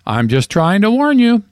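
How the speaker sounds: high-pitched squawking parrot. A narrator's reading. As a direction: squawk it high, like a talking bird. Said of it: "then squawked".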